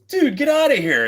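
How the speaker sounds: high, loud incredulous teammate; mocking friend. Imagined rival telling him off in a high loud voice. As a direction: scoff at him like a rival player. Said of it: "they'd be like".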